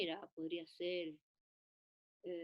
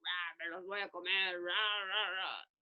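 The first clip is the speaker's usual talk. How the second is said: child's mock lion growl; playful lion; 3 dB louder, slower than usual. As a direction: roar like a pretend toy lion. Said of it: "entra un león y hace". (león de juguete, rugido de broma)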